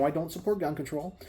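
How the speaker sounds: ordinary delivery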